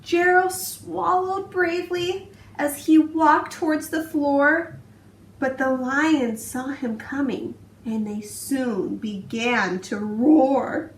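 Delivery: put-on expressive read-aloud for young children; storybook narration. A narrator's reading. Teacher reading a picture book to her class, expressive storytelling. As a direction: tell it like bedtime story time, rhythmic and lively.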